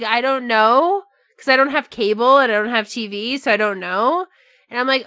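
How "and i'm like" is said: ordinary delivery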